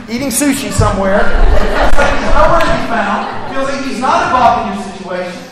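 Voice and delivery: high, rising sermon voice; fervent preaching. Acted. Pastor urging the congregation, fervent and high. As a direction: preach it to the pews with fire.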